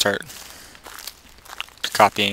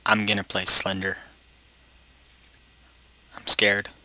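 usual speaking voice

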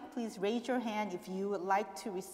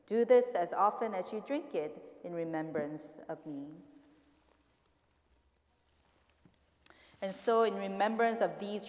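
liturgical prayer; 5 dB louder than usual. Real, not acted. Recites words of institution.

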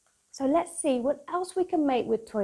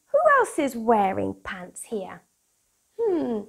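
bright, bouncy storybook read-aloud; lively picture book voice for kids; reading to children; 5 semitones higher, 8 dB louder, slower than usual. Acted. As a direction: read it like bedtime story time for little ones.

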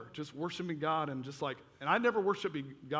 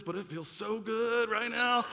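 slow, higher, hammed-up bliss; overwhelmed delight; 6 semitones higher, slower than usual. Teen feeling power roll off the man, overwhelmed delight. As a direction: stretch it out into comic ecstasy.

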